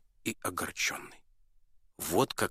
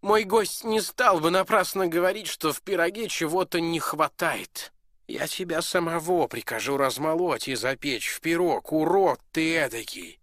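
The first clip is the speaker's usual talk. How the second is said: loud, raised furious duke; angry duke; 8 semitones higher, 6 dB louder than usual. Acted. Duke to the dwarf, loud and furious. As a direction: threaten him with the mill.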